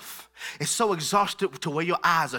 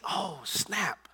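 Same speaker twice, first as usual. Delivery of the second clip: quiet realization; 10 dB quieter, slower than usual. Retold or imitated. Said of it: "Until I begin to think".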